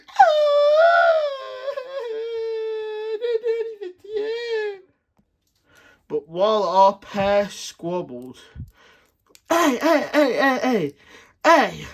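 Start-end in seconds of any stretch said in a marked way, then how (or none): 0.1-4.8 very high, slow, crying wail; mock wailing. Kai wailing at Lloyd after the punch. 9.5-11.9 loud high repeated hey; annoyed yelling. Acted. Woken neighbor yelling at the squabbling pair.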